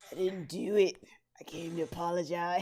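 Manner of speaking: bitter wheelchair villain. Acted. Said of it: "just like".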